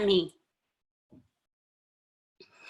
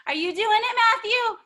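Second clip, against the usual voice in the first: ordinary talk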